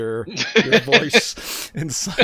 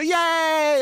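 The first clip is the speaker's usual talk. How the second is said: put-on high, drawn-out child's cheer; cheering kids; 13 semitones higher, 4 dB louder than usual. Other kids hear they are adopted, gleeful cheer. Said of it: "all the other kids go".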